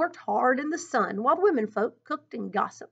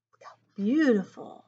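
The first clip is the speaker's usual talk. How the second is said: ordinary delivery, nothing marked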